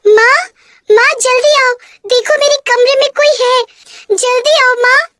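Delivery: put-on girl calling her stepmother for help, frightened. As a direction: call for mother in fear. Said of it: "माँ कमला को आवाज लगाने लगती है".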